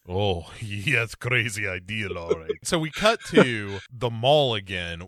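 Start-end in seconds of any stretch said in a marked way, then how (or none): 0.1-2.5 mock Russian villain, lightly put on; a hint of the Russian boss, amused; Russian boss accent. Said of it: "the Russian accent".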